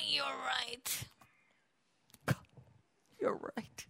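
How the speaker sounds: barely voiced surrender; soft resigned concession. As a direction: give in quietly, ego crushed. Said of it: "crushing your ego".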